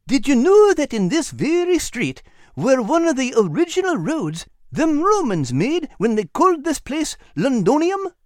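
high, eager little animal voice; Al's high voice. Acted. Al urging Nigel toward the bank, high and eager. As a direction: voice Al bright and high. Al remarks proudly.

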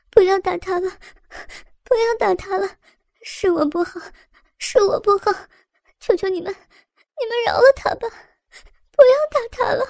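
high, rising tearful begging; crying plea. A narrator's reading. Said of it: "哭着哀求道".

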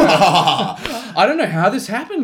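fake innocence. Playing innocent group hug player.

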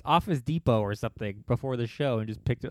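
normal talking voice

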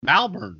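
"Malbon" copied with open MAL and American r; imitated hipster pronunciation. Shown as a demonstration. Texan guest tries out the taught word, imitated hipster pronunciation. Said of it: "you gotta say Malbon".

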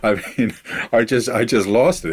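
breaking into laughter mid-sentence; can barely get it out for laughing. Real, not acted. Laughing at the Shake Weight scene.

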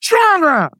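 very high, strained mock scream; imitated scream. Trainer screaming at a fire walker holding back, imitated scream. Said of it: "So he screamed at me".

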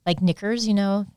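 ordinary delivery

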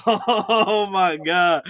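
loud, drawn-out exasperated groan; long, loud, overwhelmed retold reaction. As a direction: stretch it out in mock despair. Acted exasperation while being yelled at.

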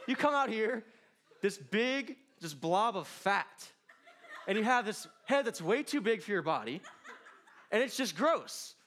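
high, loud, laughing through it; amused disgust, high and loud. Real, not acted. Preacher jokes about ugly newborns, laughing.

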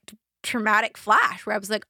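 ordinary delivery

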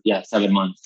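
normal talking voice

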